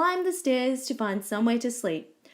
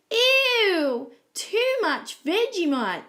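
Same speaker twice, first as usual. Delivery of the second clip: high, loud, drawn-out disgust; disgusted yell; 11 semitones higher, 4 dB louder, much slower than usual. A narrator's reading. Goldilocks tasting Daddy Koala's toast, disgusted yell. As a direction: yell it in revulsion, pitched up. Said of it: "she yelled".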